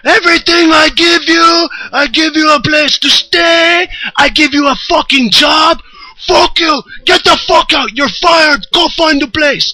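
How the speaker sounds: raging boss yelling, voice cracking near tears; boss losing it. Retold or imitated. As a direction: scream it at him like you're firing him on the spot. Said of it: "he just loses it".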